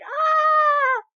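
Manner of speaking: high shrieking scream of joy; fan scream. Retold or imitated. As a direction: let out an ecstatic scream. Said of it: "I was like".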